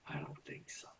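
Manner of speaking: soft whispered reply; whispering. Real, not acted. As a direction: breathe it out as a whisper. Whispered aside.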